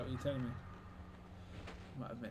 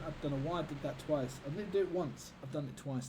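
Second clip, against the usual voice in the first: ordinary talk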